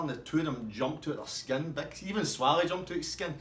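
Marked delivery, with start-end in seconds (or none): none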